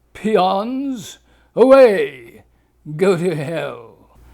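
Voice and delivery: loud snarling dismissal. A narrator's reading. The critic Grinch Simon dismissing the crowd, loud snarl.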